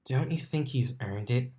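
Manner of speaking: a lightly voiced sly purr; purring inner voice. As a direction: lewd half coaxing Dash, purring. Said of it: "the voice purred".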